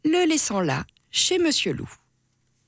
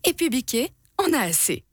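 ordinary delivery, nothing marked

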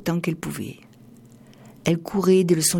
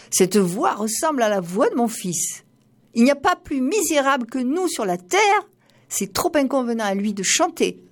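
la mère, surprise; 6 semitones higher than usual. A narrator's reading. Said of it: "se dit-elle".

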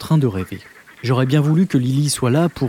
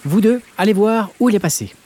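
doyenne qui ordonne; 7 semitones higher than usual. Acted. La doyenne donne un ordre.

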